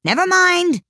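high, loud dismissal; great relief. A narrator's reading. King after the cook vanishes, relieved high cry. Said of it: "with an air of great relief".

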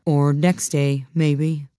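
slow, unruffled delivery; same unbothered tone. A narrator's reading. Footman carries on after the flying plate, slow and unbothered. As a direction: carry on as if nothing happened. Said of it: "continued in the same tone".

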